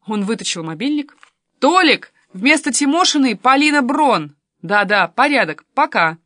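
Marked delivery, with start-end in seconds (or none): 1.6-6.2 loud, higher phone instruction, settling down at sign-off; brisk phone call. A narrator's reading. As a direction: call out the change to a colleague. Man giving instructions over his mobile. (деловито и громко в трубку)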